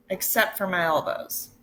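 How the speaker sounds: ordinary delivery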